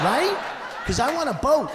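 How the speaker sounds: high, loud triumphant tag; gleeful punchline. Real, not acted. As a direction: squeal it out with glee. Punchline pitched up.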